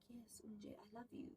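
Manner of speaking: hushed whisper of love; whispered confession. Retold or imitated. Young lover confessing to the housewife, whispered.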